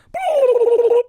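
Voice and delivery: high squealing rewind noise; tape rewinding. Shown as a demonstration. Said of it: "I'm gonna do my rewind noise".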